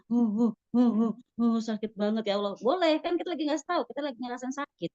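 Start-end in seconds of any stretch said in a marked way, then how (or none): 0.1-2.5 lightly acted whimpering uh, uh, then a pained plea; mock sobbing. (tangisan pura-pura yang ringan, merintih pelan)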